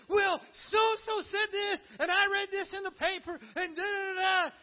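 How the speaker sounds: hammed-up panic over gossip; mock frantic. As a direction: ham up the worked-up complainer. Mock frantic believer complaining.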